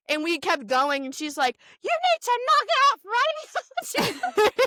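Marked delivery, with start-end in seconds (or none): 1.8-4.6 high, slow mock scolding; hammed-up angry telling-off, pitched high; mad Nana. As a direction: imitate a cross grandma laying down the law. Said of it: "Nana got so mad one year".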